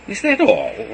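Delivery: snapping in disbelief; angry question. A narrator's reading. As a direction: demand it angrily. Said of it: "I asked angrily".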